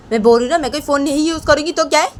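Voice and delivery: high, loud, fast outburst; angry refusal. Acted. Rohan yells at Rohit over the phone, angry. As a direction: refuse heatedly at the top of the voice.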